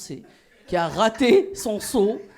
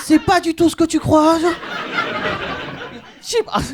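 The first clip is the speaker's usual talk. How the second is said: high, loud flustered excuse; panicked denial; 9 semitones higher, 6 dB louder than usual. Retold or imitated. Husband caught by his wife at 1 a.m., panicked high blurt. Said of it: "je lui dis".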